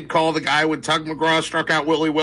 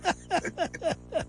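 high breathy laugh; 10 dB quieter than usual. Real, not acted. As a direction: let a high laugh burst out. Laughing at the horse story.

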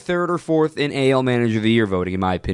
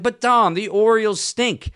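mock yelling caller; 9 semitones higher than usual. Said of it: "if you're yelling at me like".

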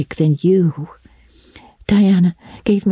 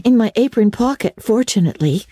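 put-on higher girlish voice, matter-of-fact; Anne, turning practical; 8 semitones higher than usual. A narrator's reading. Anne remembers her patchwork scissors, practical girlish reply. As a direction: answer as a helpful girl, pitched up.

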